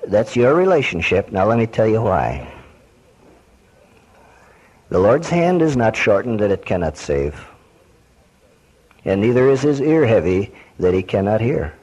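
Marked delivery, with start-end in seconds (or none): none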